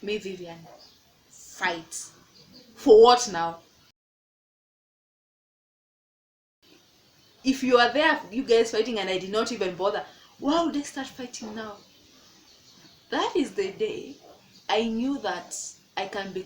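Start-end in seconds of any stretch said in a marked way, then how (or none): 0.0-11.8 inner outburst. Retold or imitated. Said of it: "in my head, I'm like".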